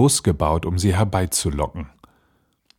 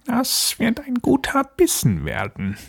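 high-pitched, gloating witch voice; witch muttering to herself. Acted. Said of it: "so murmelte sie vor sich hin". (Hexe, vor sich hin murmelnd)

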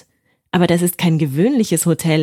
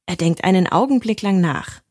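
ordinary delivery

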